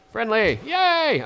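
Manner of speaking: high, loud yay; cheering. Real, not acted. Gamer cheering the friendly leader, high yay.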